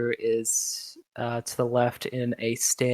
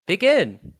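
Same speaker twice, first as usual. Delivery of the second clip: loud, high announcement; match start call; 7 semitones higher, 6 dB louder than usual. Acted. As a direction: kick off the fight.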